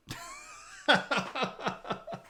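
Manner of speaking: high, quick giggling laugh; amused laugh. Real, not acted. Streamer after impersonating his wife, high giggly laugh. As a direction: crack up in high bursts.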